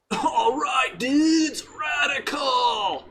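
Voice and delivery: high, loud, hammed-up surfer dude shouting. Shown as a demonstration. Asked by his friend to do a surfer voice, a high shouted surfer dude. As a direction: shout it like a cartoon surfer, stretching radical slowly. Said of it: "So now if I try to do the surfer voice".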